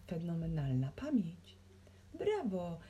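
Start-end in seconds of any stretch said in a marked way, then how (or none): none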